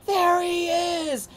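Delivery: high, slow yelled cry; sister yelling. Retold or imitated. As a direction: point him out with a yell. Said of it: "I hear my sister Lisa yell".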